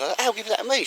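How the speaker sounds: high-pitched imitation of the wife; mimicking wife. Husband mimics his wife grabbing the pen, high-pitched. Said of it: "And she said".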